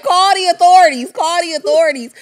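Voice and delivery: mock yell. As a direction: shout it twice like the outraged man. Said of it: "He was like".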